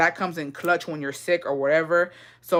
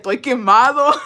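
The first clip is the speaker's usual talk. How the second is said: quote broken by laughter; 4 semitones higher, 6 dB louder than usual. Retold or imitated. Quoting dad, laughing.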